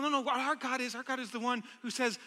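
normal talking voice

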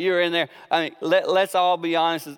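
ordinary talk

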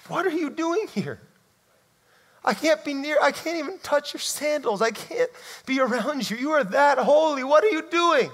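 high-pitched alarmed protest; overwhelmed Peter. Acted. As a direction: shrink back from holiness in high-pitched panic. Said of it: "It was".

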